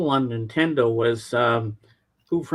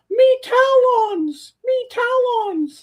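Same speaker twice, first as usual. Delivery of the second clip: very high, very slow squeaky chant; Sims gibberish; 6 dB louder, much slower than usual. Retold or imitated. Imitating how the Sims talk in gibberish, very high and slow. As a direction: stretch each syllable way up like a Sim. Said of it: "and they go".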